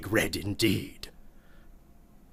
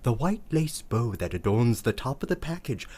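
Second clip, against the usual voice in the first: usual speaking voice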